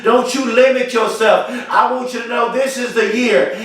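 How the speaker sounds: usual speaking voice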